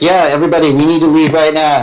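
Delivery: rally the crowd to clear out; brisk call to the group; urging everyone out. Acted. Character urges crowd to leave.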